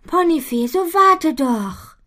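high, slow pleading call; calling after. A narrator's reading. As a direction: call after her to wait. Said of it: "ruft Butterblume ihr nach".

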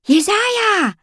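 high, happy shout of a name; joyful call. Acted. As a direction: call out his name with delight. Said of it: "rief sie erfreut".

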